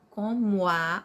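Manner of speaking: COMO A glided into co-mua; run together. Shown as a demonstration. Teacher saying listen to the sound, COMO A joined up. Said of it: "olha o som".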